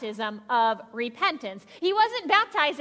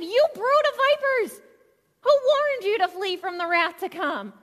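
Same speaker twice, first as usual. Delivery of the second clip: rebuking yell; 5 semitones higher than usual. Retold or imitated. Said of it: "he yelled at all the people".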